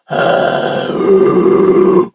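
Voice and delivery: long loud growled exhale; loud sustained growl; held angry growl. Shown as a demonstration. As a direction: hold the angry sigh as long as you can. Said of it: "So it'll go like this".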